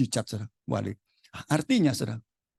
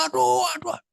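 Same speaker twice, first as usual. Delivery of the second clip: high-pitched imitated groan of "aduh"; pained wail, acted out; mock cries of pain; 12 semitones higher than usual. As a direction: imitate a patient yelping in pain, voice jumping high. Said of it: "teriak-teriak".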